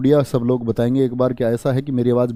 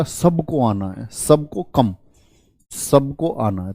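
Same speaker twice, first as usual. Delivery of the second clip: slow mnemonic; slower than usual. Shown as a demonstration. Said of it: "तो ऐसे याद कर लेना".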